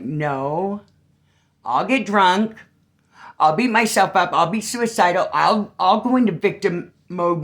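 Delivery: high and loud, pouring it out; loud, defiant self-confession; loud retold confession. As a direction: belt out your own flaws without shame. Retelling her frank reply to girl.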